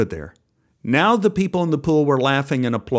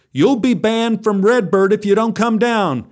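shouted threat; 8 semitones higher, 3 dB louder than usual. Acted. Lifeguard shouting threat at boy.

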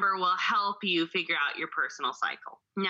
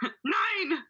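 put-on German accent; 8 semitones higher than usual. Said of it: "I feel like doing a German accent".

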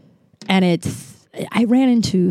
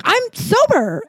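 retold announcement; 12 semitones higher, slower than usual.

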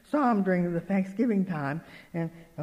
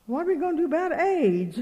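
higher-pitched mock alarm; imitated worried fretting; 8 semitones higher than usual. Worried camp lady fretting to the preacher's wife, imitated alarm. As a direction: repeat her worry in a raised, fretful voice. Repeats lady's worry, heightened.

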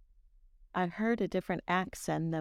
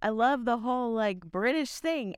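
higher-pitched mock-exasperated enthusiasm; passionate; 5 semitones higher than usual. Shown as a demonstration. Co-host prodded to show passion for Britain, passionate. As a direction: gush with passion on cue. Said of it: "Say it with some passion".

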